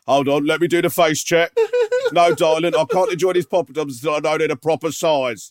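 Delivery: lightly voiced fussy husband at dinner; mock husband. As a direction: play him fussing over poppadom size. Mocking friend to his wife.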